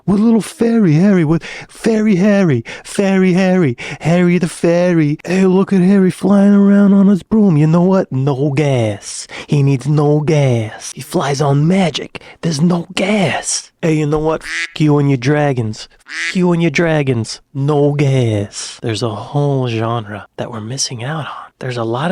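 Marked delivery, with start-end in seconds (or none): 0.0-13.7 loud, heckling New Jersey tough; New Jersey accent. Acted. Jersey local mocking Harry and his wand, New Jersey accent. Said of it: "Harry Potter goes to New Jersey". 13.8-18.8 loud, cursing New Jersey heckler; New Jersey accent. Acted. Jersey local cursing out the dragons, New Jersey accent. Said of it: "Harry Potter goes to New Jersey".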